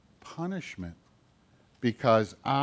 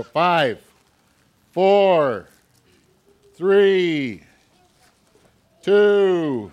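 countdown call; 5 semitones higher, 7 dB louder, much slower than usual. Real, not acted. Counting down for kids to hurry.

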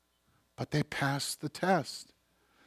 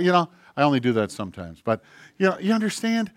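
ordinary talk, nothing marked

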